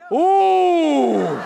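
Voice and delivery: drawn-out loud falling "ooh"; hammed-up wail of alarm; long fearful howl. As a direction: howl in comic dread at the drop. Sees the open door, terrified.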